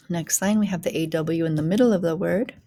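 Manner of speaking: usual speaking voice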